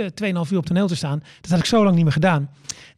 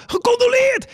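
high shouted call from the crowd; audience shout; slower than usual. Retold or imitated. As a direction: call it out from the back of the hall, pitched way up. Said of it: "begonnen mensen te schreeuwen uit de zaal".

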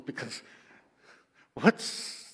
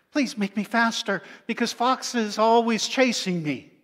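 pleading rabbit; 7 semitones higher, 8 dB louder than usual. Acted.

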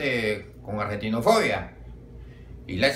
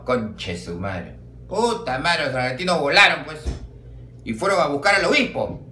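usual speaking voice

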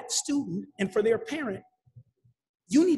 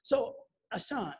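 ordinary talk

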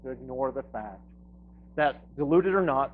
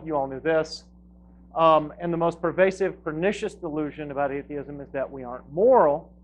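ordinary delivery

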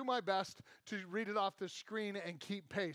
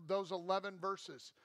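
ordinary talk

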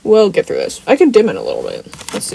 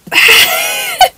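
very high, squealing real laugh; helpless laughter. Real, not acted. Streamer recalling a horrible accent at play practice, cracking up. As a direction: burst into a shrieking laugh. Cracking up over retold embarrassment.

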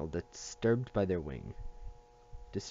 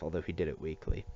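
ordinary delivery

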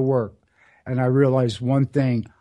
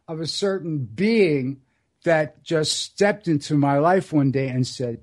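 usual speaking voice

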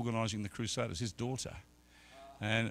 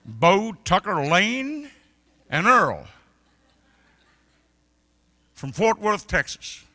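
grand introduction; 9 semitones higher, 12 dB louder, slower than usual. Retold or imitated. Names Texan family with flourish.

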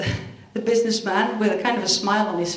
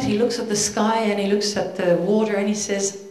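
ordinary talk

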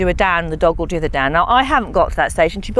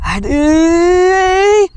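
loud, high, drawn-out ready; excited call; 8 semitones higher, 5 dB louder than usual. Real, not acted. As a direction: hype the spaniel up. Revving up the spaniel.

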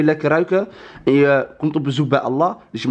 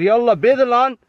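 shouting; 7 semitones higher than usual. Real, not acted. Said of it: "Hoezo schreeuw je?".

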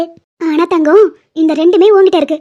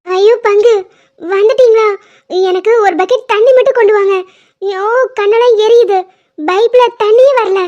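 distressed shouting; 3 semitones higher, 3 dB louder than usual. Acted. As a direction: yell out in pain, calling for anyone. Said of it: "அவ தண்ணி தண்ணின்னு கத்திக்கிட்டு இருக்கா". (கண் எரிச்சலில் உதவி கேட்டு சத்தமாக அலறல்)

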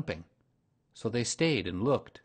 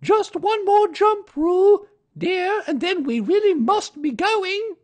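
very high, louder motherly kangaroo voice; 13 dB louder than usual. A narrator's reading. Kanga coaxes Baby Roo to jump once more, very high. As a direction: coax a little one in a high sing-song.

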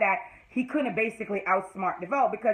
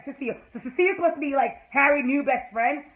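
ordinary delivery

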